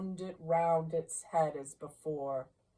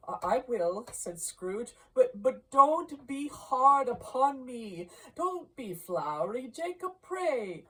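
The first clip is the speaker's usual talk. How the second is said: put-on higher, stammering, pleading old man; frightened pleading; 8 semitones higher, 5 dB louder than usual. A narrator's reading. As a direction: beg nervously not to be judged harshly.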